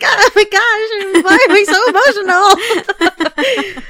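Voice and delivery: high, loud and slow, laughing as you speak; half-laughing, half-teary retelling; laughing through tears. Retold or imitated. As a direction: giggle at your own emotional overflow. Retelling crying at engagement.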